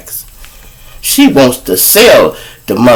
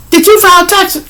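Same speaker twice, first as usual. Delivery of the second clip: suspicious woman. Retold or imitated. Baby mama fishing for the truth about taxes, squeaky and suspicious. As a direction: needle him with a squeaky suspicious question.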